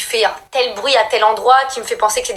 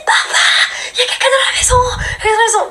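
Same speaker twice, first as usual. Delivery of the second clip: high, crying shout for dad; panicked scream; 13 semitones higher, faster than usual. Retold or imitated. Terrified sister screaming to dad, crying shout. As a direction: scream for help through tears.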